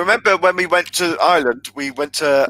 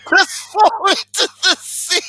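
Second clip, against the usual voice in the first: high, slow squeal through laughter; laughing while talking; 14 semitones higher, 3 dB louder than usual. Real, not acted. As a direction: barely get the punchline out, laughing. Cracking up at own punchline.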